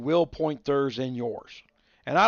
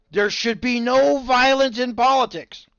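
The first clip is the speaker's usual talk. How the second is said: mocking politician; 9 semitones higher, 6 dB louder than usual.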